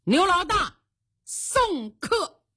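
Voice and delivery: high-pitched shouted order; loud call. Acted. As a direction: call it out sharply to someone outside. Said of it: "就听丁啸天大喊一声".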